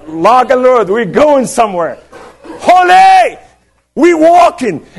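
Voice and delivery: high, loud battle cries; rallying shout. Retold or imitated. Preacher leading the team to the gates, high triumphant shouting. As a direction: whoop it like leading a charge.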